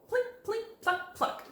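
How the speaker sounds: high, light plinking words; playful high string imitation; mock guitar plucks. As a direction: pluck each word like a string.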